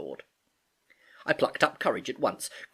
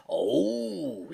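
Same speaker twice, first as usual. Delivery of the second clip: drawn-out, higher sailor's Oh; exclaimed Oh; 5 semitones higher than usual. A narrator's reading. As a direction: let the Oh swell with recognition. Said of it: "quite loud".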